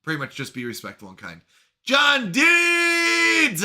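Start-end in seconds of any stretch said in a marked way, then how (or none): 1.8-3.6 excited shout. Real, not acted. Streamer hailing a viewer who just arrived, excited shout. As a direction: holler the name like a hype announcer. Greeting a viewer joining chat.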